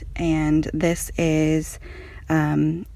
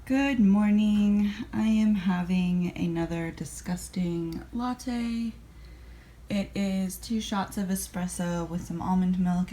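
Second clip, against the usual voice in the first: ordinary delivery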